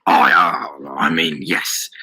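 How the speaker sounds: low voice through a forced smile; smiling bluff. A narrator's reading. Daniel corrects himself to the shopkeeper, smiling low. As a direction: cover a slip with a smile. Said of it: "Daniel said, smiling through yellow teeth".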